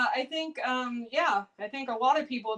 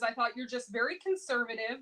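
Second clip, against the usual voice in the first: normal talking voice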